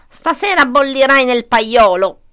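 loud, relishing villain; gloating fox. A narrator's reading. Fox gloats over the captured hen, gleeful. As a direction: gloat loudly over your catch. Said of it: "si rallegrò la volpe".

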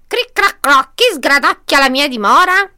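high, loud witch voice; horrible witch. A narrator's reading. Witch catches someone nibbling her house, screeching challenge. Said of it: "una strega orrenda".

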